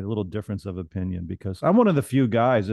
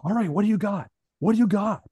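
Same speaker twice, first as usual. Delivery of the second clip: high, eager prompting; pitched-up, bouncy anticipation; bouncy doctor; 9 semitones higher than usual. Retold or imitated. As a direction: imitate an excitable doctor awaiting the answer. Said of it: "He's bouncing it".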